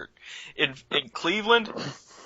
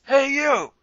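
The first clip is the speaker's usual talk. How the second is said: much higher mock call of a cop; 11 semitones higher than usual. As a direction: hail him like a cop, pitched way up. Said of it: "the cop pulls up and goes".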